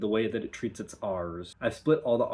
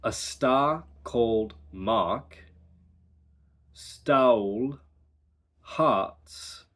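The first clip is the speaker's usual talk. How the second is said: slow, R-less reading; careful dialect practice; accent drill sentence; slower than usual. Shown as a demonstration. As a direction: draw out the ah vowels. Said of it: "The practice sentence is".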